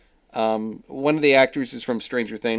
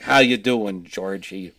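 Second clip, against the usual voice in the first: lightly imitating Tim Curry's Brooklyn Pennywise; Brooklyn accent; 2 semitones lower, 5 dB louder, slower than usual. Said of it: "he did it with an inconsistent Brooklyn accent".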